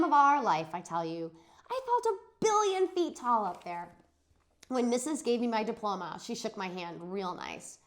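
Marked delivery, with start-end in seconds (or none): none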